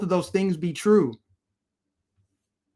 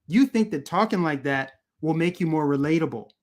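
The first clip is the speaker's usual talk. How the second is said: ordinary delivery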